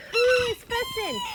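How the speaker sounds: high squeaky voice for the goat; high pet voice. Real, not acted. Baby talk to goat.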